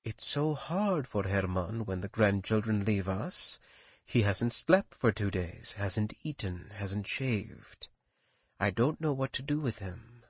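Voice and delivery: hushed, soft confiding voice; almost a whisper. A narrator's reading. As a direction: confide it under your breath, worried. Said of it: "almost in a whisper".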